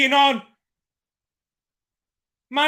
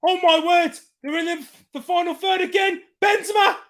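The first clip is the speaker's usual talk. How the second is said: ordinary talk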